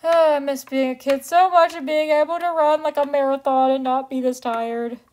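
normal talking voice

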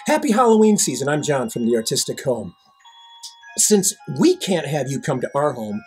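ordinary delivery

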